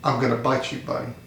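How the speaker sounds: low, softened voice for a storybook snake; mock whisper. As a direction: quietly confide a threat, imitating a whisper. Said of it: "the snake whispers in the bunny's ear".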